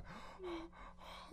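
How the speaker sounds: sharp high-pitched gasp; breathless gasp. Real, not acted. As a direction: gasp for air, overwhelmed. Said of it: "can't breathe in between all of this".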